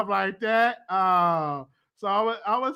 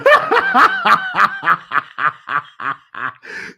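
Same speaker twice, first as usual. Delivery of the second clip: high, loud burst of laughter; 14 semitones higher, 9 dB louder than usual. Real, not acted.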